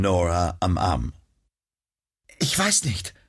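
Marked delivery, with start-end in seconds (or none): 2.4-3.1 shaken. Acted. Shaken victim after attack.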